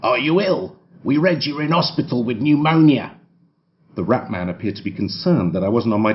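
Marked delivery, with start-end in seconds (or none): 0.0-3.1 lightly voiced rat-like snapper; a hint of a prying doorstep photographer; rat man. A narrator's reading. Rat-like photographer quizzing the sick man at his door, lightly put-on voice.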